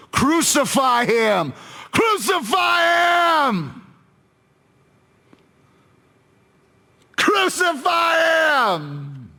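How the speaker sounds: very high, drawn-out mob scream; strained, high-pitched, slow shout; crying out. Acted. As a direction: yell for his death with the crowd. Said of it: "they cried out".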